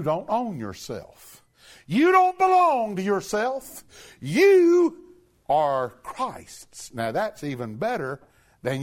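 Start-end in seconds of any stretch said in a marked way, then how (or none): 1.9-6.9 high, loud climax; preaching shout. Real, not acted. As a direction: cry it out to the congregation.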